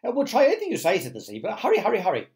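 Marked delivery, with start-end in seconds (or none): 0.0-1.0 high, loud eagerness; frantic readiness; desperate urging. A narrator's reading. Desperate creatures press James. 1.4-2.3 high, loud, rapid-fire plea; urgent repeated cry; frantic urging. A narrator's reading. Desperate creatures press James.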